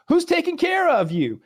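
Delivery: very high, pushy-chummy salesman; mock his sales pitch way up high; mocking bug man. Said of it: "He's like".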